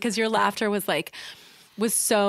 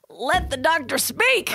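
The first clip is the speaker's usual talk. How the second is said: higher, drawn-out mock protest; playfully hammed-up demand; mock indignation; 7 semitones higher, slower than usual. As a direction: imitate an exasperated listener, half laughing. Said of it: "I interrupt you, that you're like".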